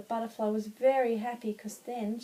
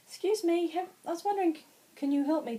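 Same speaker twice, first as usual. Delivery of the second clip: higher, louder little butterfly asking politely; 8 semitones higher, 5 dB louder, faster than usual. Acted. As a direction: ask sweetly as a tiny butterfly, pitched up. Butterfly asks the girl politely.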